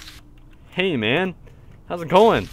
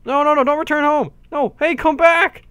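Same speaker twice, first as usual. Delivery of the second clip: desperate calling out; 11 semitones higher, 6 dB louder than usual. Real, not acted. Players leaving him behind.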